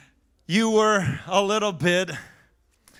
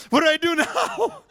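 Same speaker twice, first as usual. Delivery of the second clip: laughing dismay; 7 semitones higher than usual. Retold or imitated. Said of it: "I was like".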